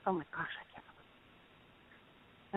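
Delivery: fast breathless whisper of disbelief; a hushed, rapid gasp of shock; stunned whisper. Retold or imitated. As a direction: rush it out under your breath, amazed. Shocked at the Lord's words.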